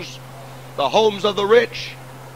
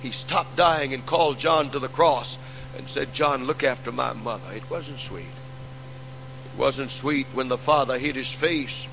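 hushed sermon narration; 5 semitones lower than usual. Acted. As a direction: draw the congregation in close with a falling voice. Describes crucifixion, voice sinking.

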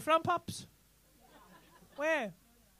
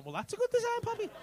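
much higher-pitched mock-impressed reply; mock admiration; 12 semitones higher than usual. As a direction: gush it way up high, teasingly. Acts out admiring reply to first take.

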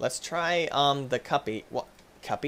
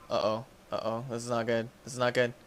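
ordinary talk

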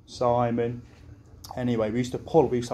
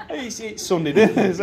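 laughing through it; giggling the excuse; 8 semitones higher, 6 dB louder than usual. Real, not acted. Quoting himself to friends, laughing.

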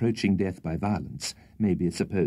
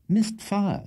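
much higher, strained admission; dismayed voice; 12 semitones higher than usual. Acted. Pale Winkle admits his gun misfired, high and dismayed. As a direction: squeak it out, caught out.

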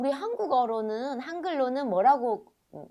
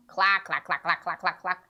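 low, rapid-fire clucks; hen clucking imitation; 5 semitones lower, much slower than usual. Teacher giving kids a hen clue, clucking imitation. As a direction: chatter like a hen, pitched down.

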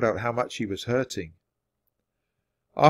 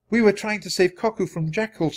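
higher, lightly voiced girl explaining; Annie's voice; 8 semitones higher than usual. A narrator's reading. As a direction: lightly pitch it up for a child's account.

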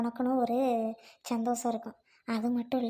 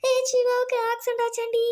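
distressed telling; 12 semitones higher, 6 dB louder than usual. Acted. Tells sister the bad news.

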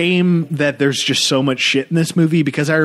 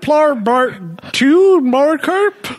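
high, slow, hammed-up Midwestern dad; Midwestern accent; 13 semitones higher, 4 dB louder, slower than usual. Dad answering his buddy, hammed-up Midwestern accent. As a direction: stretch it out like a goofy Midwestern dad. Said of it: "you do a great, uh, Midwestern accent".

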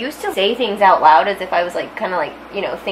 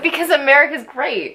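mock-patriotic sarcasm, slightly higher; sarcastic; 6 semitones higher than usual. Friend joking about falling in line, sarcastic. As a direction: praise it without meaning a word. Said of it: "That was sarcasm".

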